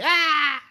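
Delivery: high pained shout; agonized cry. Retold or imitated. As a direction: cry out in agony. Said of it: "or is it like an agony".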